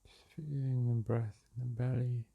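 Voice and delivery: calm, unhurried meditation voice with long pauses; soothing meditation guide, leaving space between phrases; guided meditation. Acted. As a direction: guide them gently back to the breath.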